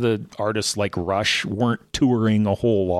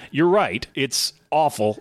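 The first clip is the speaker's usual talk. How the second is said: usual speaking voice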